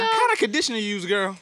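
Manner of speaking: put-on high, loud, fast girlish gush; hammed-up fan voice. Host mimics a fan asking about conditioner, high and loud.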